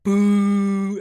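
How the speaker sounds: long, loud, high boo; crowd booing. Acted. Crowd heckling the interrupted fight, loud drawn-out jeer.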